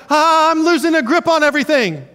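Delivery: high, loud gasp of losing grip; mock panic, voice jumping up; hammed-up alarm; panicked cry. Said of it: "we feel like".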